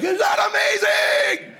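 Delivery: high, slow excited shout; over-the-top elation. Retold or imitated. Dad celebrating before other fathers, over-the-top elated shout. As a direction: stretch it out in wild delight.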